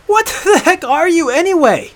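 high, loud, outraged yell; bellowed. Acted. Hunter demanding what Aela is, bellowing. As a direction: find your strength and bellow it. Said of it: "found strength and he bellowed".

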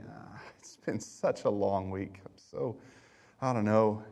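low, soft, worn-out grumble; mock weary sigh. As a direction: act out a drained, dragging complaint. Mock tired small talk.

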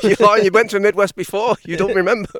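loud, high mock accusation; bird heckling. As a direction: shout like the bird calling him a liar. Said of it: "he's like".

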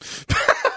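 shrieking laugh, high and breathless; high squealing laugh. Real, not acted. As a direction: burst into a high giggle. Listener cracks up at vampire voice.

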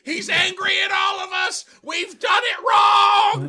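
very high, very loud and slow wailing pig voice; terrified hog wail. Acted. Dying hog seeing an angry God, terrified wailing. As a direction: wail in holy terror.

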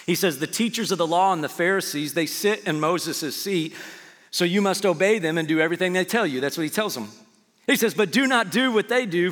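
full-force preaching. Acted. Pastor preaching scripture to the church, loud and fervent. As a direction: preach it hard to the congregation.

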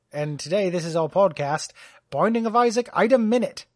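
a playful British podcast intro; mock British host. Host opens the joke British podcast in a hammed-up British accent. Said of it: "with the British version".

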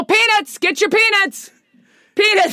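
loud ballpark peanut vendor call; stadium vendor hawking. Retold or imitated. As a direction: bellow it like a ballpark hawker. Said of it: "You're gonna go".

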